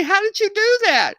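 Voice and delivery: stunned men at the range, loud and high; astonished. Retold or imitated. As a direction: ask it like you saw a miracle. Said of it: "They say".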